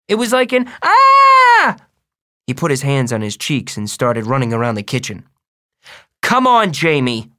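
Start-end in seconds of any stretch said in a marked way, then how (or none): 0.1-1.8 small boy acting out a long, high shriek; high kid voice rising into a loud "ah"; demonstrated yell. A narrator's reading. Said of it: "It wasn't a scream". 6.2-7.3 loud, high start that drops on the name; mother angrily pulling up her son; angry. A narrator's reading. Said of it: "said Mom angrily".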